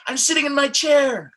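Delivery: high, loud and bright with excitement; excited. Shown as a demonstration. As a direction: burst it out eagerly. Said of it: "There's an emotion behind what I'm saying".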